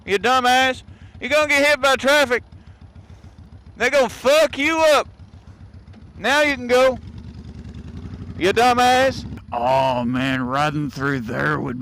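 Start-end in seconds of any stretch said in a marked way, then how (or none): 0.0-7.0 yelling at pedestrian. Real, not acted. 8.4-9.3 loud, high, exasperated scolding; yelling at pedestrian. Real, not acted. Rider yelling at jaywalker from his bike, loud and higher.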